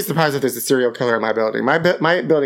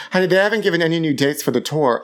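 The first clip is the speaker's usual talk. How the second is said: ordinary talk, nothing marked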